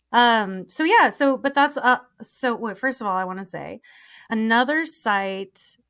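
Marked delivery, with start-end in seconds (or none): none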